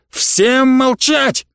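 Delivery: high, drawn-out command; barked order. A narrator's reading. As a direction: bark it at everyone, stretching the words. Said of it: "рявкнул профессор".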